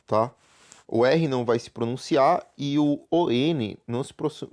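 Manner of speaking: ordinary talk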